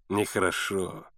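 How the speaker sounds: smug, low disapproval; insolent smile. A narrator's reading. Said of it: "нагло улыбаясь, повторил лодочник".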